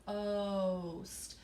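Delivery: "boast" with a long held O; stretched out. Shown as a demonstration. Teacher sounding out the word, "boast" stretched slowly. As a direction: draw out the vowel in "boast". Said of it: "if I stretch it out".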